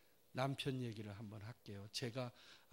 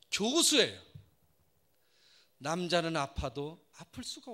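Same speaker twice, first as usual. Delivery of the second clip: much louder, higher sermon cry; full-force preaching; 12 semitones higher, 21 dB louder than usual. Acted. Preacher crying out to the congregation about men, full force. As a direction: preach it to the rafters.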